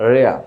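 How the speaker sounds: রেয়া with the final R left silent; British pronunciation. Shown as a demonstration. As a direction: end রেয়া on an open vowel, no R. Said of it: "আর এর উচ্চারণটা এখানে". (রেয়া, শেষে র ছাড়া British উচ্চারণ)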